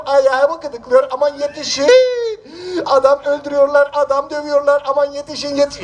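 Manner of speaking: hammed-up cry for help. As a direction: wail it out like a beaten man calling for help. Wailing for help in street.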